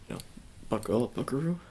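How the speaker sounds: normal talking voice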